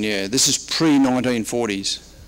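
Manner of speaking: ordinary delivery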